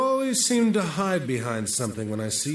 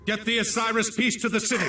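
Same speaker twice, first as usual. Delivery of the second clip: urgent shout; 10 semitones higher than usual. Acted. Commander shouting an order to his men during an ambush, urgent shout. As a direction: yell for them to get the piece away.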